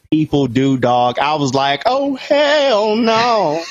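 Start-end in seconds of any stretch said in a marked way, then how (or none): 1.8-3.6 long, high-pitched drawn-out "oh hell no"; theatrical shocked wail; appalled refusal. Retold or imitated. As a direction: stretch it out high in exaggerated disbelief. Shocked reaction in retold story.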